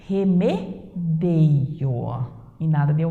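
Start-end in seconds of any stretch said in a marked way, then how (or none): 0.1-1.9 very slow "remedeio", each syllable drawn out. Shown as a demonstration. Teacher to class, slowly spells out "remedeio" syllable by syllable. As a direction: stretch "remedeio", stress on DEI. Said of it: "O verbo correspondente a remediar é eu".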